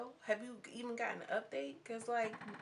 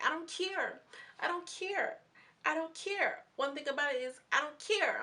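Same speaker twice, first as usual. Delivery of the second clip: imitating Yola; 8 semitones higher, 4 dB louder than usual. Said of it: "Yola's like".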